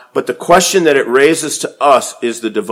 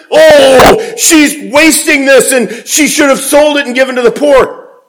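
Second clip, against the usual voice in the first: mocking outraged disciples; 10 semitones higher, 5 dB louder than usual. Preacher mocks the disciples' outcry.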